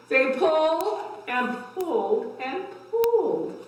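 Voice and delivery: lively, rising read-aloud for little ones; story time voice for a class; reading to children. A narrator's reading. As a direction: build the effort with each pull. Reads cumulative tale to kids.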